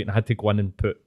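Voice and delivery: ordinary delivery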